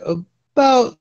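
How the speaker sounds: audio cutting out mid-sentence; gappy, dropping call audio; bad connection. Real, not acted. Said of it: "you're cutting out again there".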